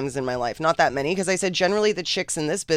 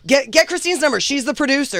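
raised, higher, louder repeat; exasperated insistence; 11 semitones higher, 5 dB louder than usual. Retold or imitated. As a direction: push it on her, fed up. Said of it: "Finally, he's like".

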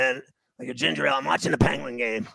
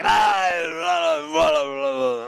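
put-on drunk babble; 3 semitones higher, slower than usual. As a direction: imitate a drunk guy's sloppy babble. Drunk guy babbling in face.

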